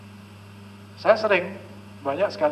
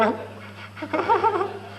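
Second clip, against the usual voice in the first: high-pitched giggle; high laugh. Real, not acted. Speaker laughs.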